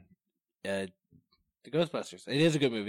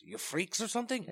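soft, high mock French accent; put-on French accent, higher and hushed; 9 semitones higher, 7 dB quieter than usual. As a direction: attempt a French accent, quietly. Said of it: "yeah, with the French one".

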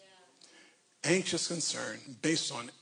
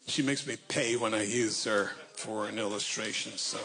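ordinary talk, nothing marked

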